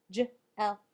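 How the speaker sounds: J, L as separate sounds, juh, eh, ull; J, L said by sound, not letter name. Shown as a demonstration. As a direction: sound out J, L phonetically with gaps, loudly. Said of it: "let's tap it out again".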